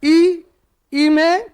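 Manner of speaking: ordinary talk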